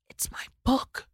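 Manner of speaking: breathless whisper, barely voiced; choked whisper. To her husband, overwhelmed by her first book: whispered. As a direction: barely get the words out in a whisper. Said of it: "all I could eke out were the words".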